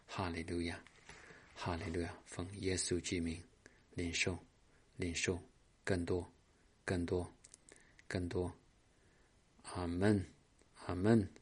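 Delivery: soft, murmured charismatic prayer; a pastor praying softly over the congregation; hushed prayer. Acted. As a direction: pray quietly, welcoming the Spirit. Leads a soft Spirit prayer.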